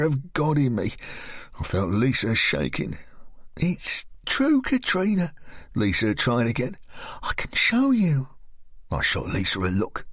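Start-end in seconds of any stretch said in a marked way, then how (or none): 3.6-5.3 put-on higher, friendly young woman's voice; higher, coaxing woman's voice; Lisa's voice. Narrator voices Lisa. 7.2-8.3 much higher, breathless whisper; higher, whispered as Lisa; whispered offer. Acted.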